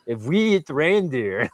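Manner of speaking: slow, careful mock Swedish accent; a hint of a Swedish accent; attempted Swedish accent. Quoting the Swedish girl again, in a light Swedish accent. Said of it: "I can't do the Swedish accent".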